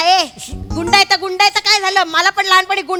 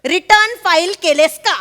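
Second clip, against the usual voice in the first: pointed repeat. Acted. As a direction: stretch each word to press for an answer. Repeats question slowly and pointedly.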